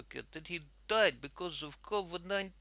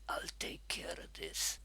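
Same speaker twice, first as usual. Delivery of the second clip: whispered reassurance, no voice; conspiratorial whisper; 6 dB quieter than usual. Retold or imitated. Husband secretly reassuring his wife before taking the phone, whispered.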